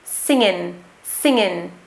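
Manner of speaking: singing said "singin", no final G; West Country IN ending. Shown as a demonstration. Teacher drilling learners, West Country IN ending. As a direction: finish singing with N, tongue forward. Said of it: "with the tongue moving at the front of our mouths".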